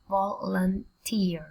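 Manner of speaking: slow Volunteer in three separate pieces; syllable by syllable. Shown as a demonstration. Teacher sounds out a word, slowly by syllables. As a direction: split Volunteer as vol, un, teer.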